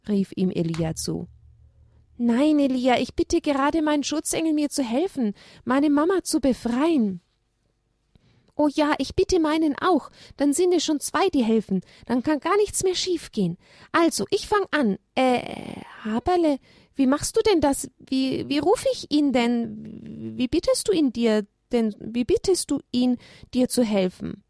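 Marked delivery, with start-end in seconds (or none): 2.2-7.2 put-on higher childlike lamb voice, earnest; little lamb. Lamb explains to Elia. 8.6-24.4 higher, bumbling donkey voice, unsure; eager little donkey. Acted. Donkey unsure how to pray.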